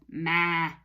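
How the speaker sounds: one low, drawn-out bleat; goat bleat. Shown as a demonstration. Teacher telling children what the goat says, bleating. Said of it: "The goat says".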